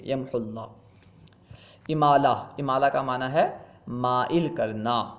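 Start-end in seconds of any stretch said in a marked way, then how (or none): 0.0-0.7 "یمحو اللہ" run together with short u; joined, waw silent. Shown as a demonstration. Teacher sums up the rule, "یمحو اللہ" with silent waw. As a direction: go straight from "یمحو" into the lam. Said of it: "اس طرح پڑھیں گے".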